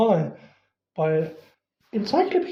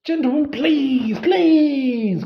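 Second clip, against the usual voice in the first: high, louder desperate plea; cried out; 8 semitones higher, 3 dB louder, much slower than usual. A narrator's reading. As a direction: beg them to stop, rising in panic. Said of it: "cried the assistant".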